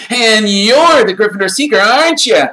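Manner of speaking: put-on high, loud awestruck boy; in awe. A narrator's reading. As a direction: marvel at your hero. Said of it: "said Colin in awe".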